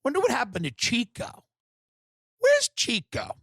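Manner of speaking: wondering aloud. Retold or imitated. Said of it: "I'll be like".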